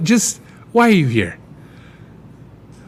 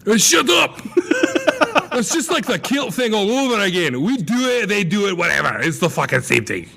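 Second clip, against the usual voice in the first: real laughter breaking the words; laughing; 9 semitones higher than usual. Real, not acted. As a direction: laugh through the telling-off. Laughing protest at friend.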